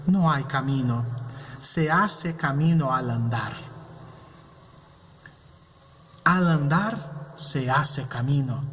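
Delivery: slow, measured recital of a Spanish poem; teacher reading Machado aloud slowly. Shown as a demonstration. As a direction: read the verse aloud slowly, with pauses. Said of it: "prestem atenção ao poema".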